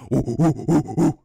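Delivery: low hooting ooh like a monkey; low monkey noise. Shown as a demonstration. Teaching viewers the low monkey noise, hooting. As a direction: hoot it out, low and round. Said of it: "First is the low one".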